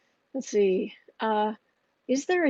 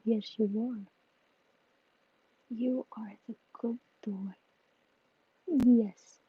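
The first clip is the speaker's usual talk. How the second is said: tender pet talk, voice dropping softer; cooing to the cat; 4 dB quieter than usual. Real, not acted. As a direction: coo gently over the kitty.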